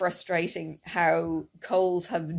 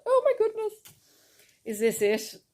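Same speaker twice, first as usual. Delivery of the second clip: mock alarm; 14 semitones higher than usual. Anxious inner thought quote.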